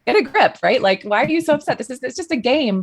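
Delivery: put-on a touch of a dad brushing off a kid upset over a game; husband scolding. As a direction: imitate an exasperated husband telling the kid to get a grip. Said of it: "my husband is like".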